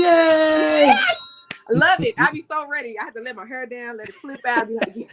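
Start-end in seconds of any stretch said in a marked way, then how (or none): 0.0-3.0 loud, high, delighted; thrilled shout of approval; loud and high, thrilled by a friend's rhyming intro; excited. Real, not acted. Reacting to his hype intro.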